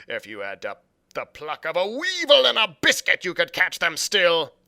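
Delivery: loud furious blind pirate; Pew berating. Acted. Pew raging at his crew for cowardice, loud. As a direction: tear into the cowards.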